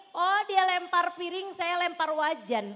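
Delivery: loud imitation of a wife snapping back; mock angry retort. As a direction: throw it back at him, hammed-up and loud. Wife retaliating against angry husband. (istri membalas suami yang marah, dengan suara keras pura-pura marah)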